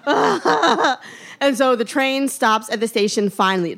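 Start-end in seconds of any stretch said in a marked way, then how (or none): 0.1-1.0 high helpless panic cry with a laugh; a mock panicked yelp; panicked wail. Woman holding a stranger's hand, a high mock panicked cry. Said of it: "But I'm just like".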